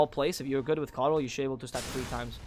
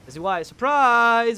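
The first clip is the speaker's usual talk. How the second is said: high, loud, drawn-out surprise call; excited exclamation; 7 semitones higher, 10 dB louder, slower than usual. Real, not acted. Caster springing an ambush on F Z Y, excited shout. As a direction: sing out the surprise, stretched and loud.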